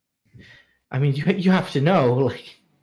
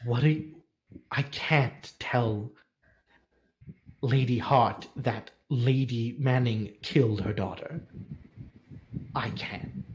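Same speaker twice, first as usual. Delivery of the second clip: a lightly voiced old servant, lower and softer; hint of a grieving, devoted maester; sorrowful maester; 4 semitones lower, 4 dB quieter than usual. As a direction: tell it heavily, weighed down by guilt. Maester's long lament.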